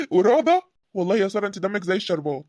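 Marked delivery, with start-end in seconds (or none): 0.0-0.6 laughing question. Acted. Laughing teasing reply to compliment.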